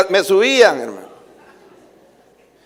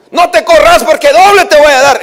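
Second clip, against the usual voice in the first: shouted mother's threat, higher and louder; mock angry mother; 8 semitones higher, 6 dB louder than usual. Preacher voicing his mother threatening him as a boy, mock angry shout. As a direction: bark the threat like a fed-up mother.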